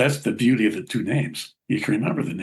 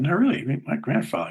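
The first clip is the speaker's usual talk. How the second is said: put-on kid insisting; 6 semitones higher than usual. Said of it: "my grandson's going".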